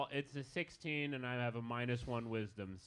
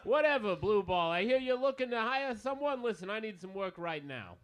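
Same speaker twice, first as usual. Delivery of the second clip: loud, higher shouted banter; yelling across the bar; 6 semitones higher, 4 dB louder than usual. Acted. Player's character shouting to Bronte across the bar, loud high yell. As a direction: keep hollering across the bar. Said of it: "Do you two have to yell from across the bar".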